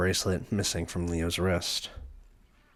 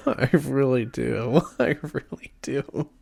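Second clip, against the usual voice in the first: high, drawn-out laughing words; helpless laughing delivery; 6 semitones higher, slower than usual. Real, not acted. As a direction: say it slowly through laughter. Cracking up while playing.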